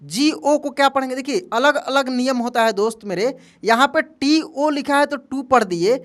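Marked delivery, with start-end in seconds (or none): none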